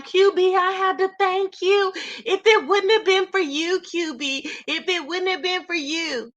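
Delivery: high, slow whiny mock sob; mock crying plea. Mocking Phi Babe crying to Q B, high whiny plea. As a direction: mimic her crying thanks, hammed up. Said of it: "She went crying".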